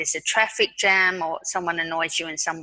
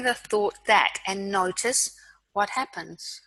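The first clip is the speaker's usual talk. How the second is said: guided mindfulness. Acted.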